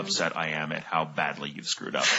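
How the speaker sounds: a hint of a hurt mother, sinking low; disappointed mom. Host voicing his guest's mom look, mock disappointed. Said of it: "It's also a look of".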